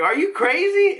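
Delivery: high, outraged disbelief; mock-outraged high retort; incredulous. Retold outraged reply to doctor.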